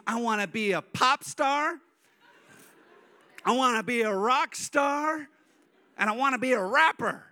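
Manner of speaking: put-on high, proud little-kid voice; five-year-old daughter. Daughter telling dad her dream jobs, high childish declaration. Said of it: "She goes".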